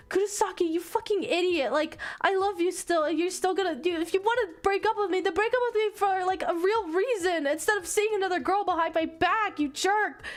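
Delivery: put-on high, heated girlish tirade; mad girlfriend. Main girl telling off Kurosaki in class, mad and high. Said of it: "main girl gets mad".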